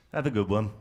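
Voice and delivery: low, deadpan send-off; cynical, downbeat English. Shown as a demonstration. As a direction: drop it down like a cynical Brit. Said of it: "much more cynical and downbeat".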